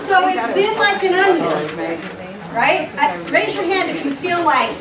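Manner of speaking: usual speaking voice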